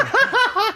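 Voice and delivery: high laughing outburst; high burst of laughter. Real, not acted. Laughing at his own jealousy, high burst of laughter.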